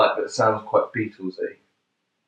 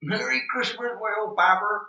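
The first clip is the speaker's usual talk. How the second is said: put-on West Country accent; 11 semitones higher, 4 dB quieter than usual. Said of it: "in a West Country accent".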